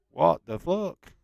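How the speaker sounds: fed-up fan venting at the match card; exasperated outburst. Real, not acted. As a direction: vent at the empty card. Outraged at only one match announced.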